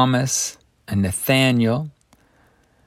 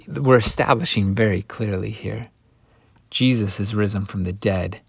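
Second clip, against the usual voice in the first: ordinary talk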